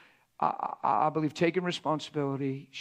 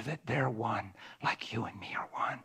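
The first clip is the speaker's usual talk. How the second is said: whisper; 5 semitones lower, 4 dB quieter than usual. Acted. Quoting prayer softly.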